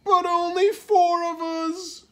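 high, loud, slow lament; alarmed. A narrator's reading. Friends face six pieces for four, alarmed. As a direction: wail the new count in dismay.

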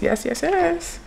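ordinary delivery

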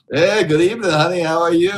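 loud, high leering lounge-lizard greeting; sleazy greeter. Acted. Streamer greets Omegle strangers as a leering creep, loud and high. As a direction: belt it out like a creepy host hitting on strangers.

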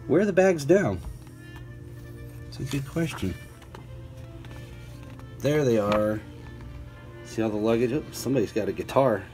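ordinary delivery

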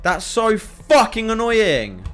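high, drawn-out exasperated complaint; loud annoyance. Real, not acted. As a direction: drag it out, loudly fed up. Annoyed at the game.

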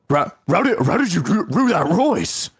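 high, swooping, stuttered doggy voice; cartoon talking dog. Streamer as the dog asking a question, cartoon dog voice. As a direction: turn word starts into doggy R sounds.

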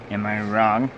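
ordinary talk

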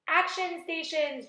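loud, high slow command; shouting. A narrator's reading. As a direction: bark out the order. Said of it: "James shouted".

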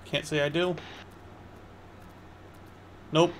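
ordinary delivery

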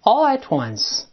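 slow, linked: "All at once" run together as "al-la-twans". Shown as a demonstration. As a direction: let "all at once" flow as one word, t carried to once. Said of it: "连到一块儿，再来一遍".

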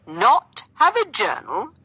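high, loud, drawn-out disbelief; theatrical astonishment. A narrator's reading. Gentleman shocked she keeps no journal, theatrically astonished. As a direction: overplay the shock with swooping pitch.

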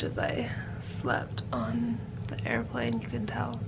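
tired late-night whisper. Real, not acted. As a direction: hush it out, unvoiced.

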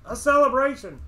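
very high, loud outburst; exultant cry. Acted. Innkeeper carried away describing the village festival, exultant cry.

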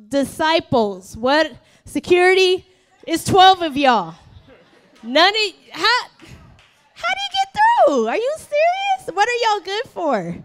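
mock outrage, jumping high and loud; exasperated, voice leaping up into a squeal; incredulous outrage. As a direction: call out the useless security in disbelief. Imagines own outraged reaction to leper.

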